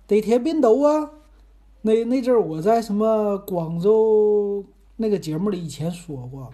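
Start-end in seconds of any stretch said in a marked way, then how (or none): 0.1-1.1 high, loud Cantonese question. Shown as a demonstration. Host showing off Guangzhou phrases, Cantonese attempt. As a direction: ask it in learner's Cantonese, pitched up. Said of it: "我也能整两句儿".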